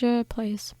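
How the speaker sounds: breathy whispered trail-off. Real, not acted. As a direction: let it fade into a whisper.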